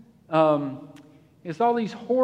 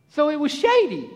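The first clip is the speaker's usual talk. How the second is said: lazy Israelite voice; 6 semitones higher than usual. Pretend. Israelites lounging under the cloud, higher and savoring. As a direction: savor the shade in a character voice. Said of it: "There I am again".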